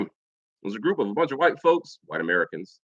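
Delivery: ordinary talk, nothing marked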